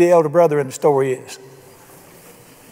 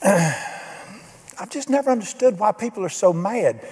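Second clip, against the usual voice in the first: slow bewildered; 4 semitones higher, 3 dB quieter, slower than usual. Real, not acted. Preacher's slow bewildered aside.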